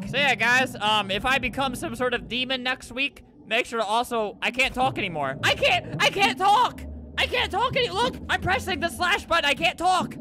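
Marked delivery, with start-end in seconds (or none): 5.4-10.1 high-pitched, flustered insistence; worked up, voice pitched high; flustered panic. Real, not acted. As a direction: protest in a rising panic. Player panics that chat fails.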